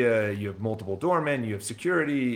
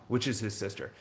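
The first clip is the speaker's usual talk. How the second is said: ordinary delivery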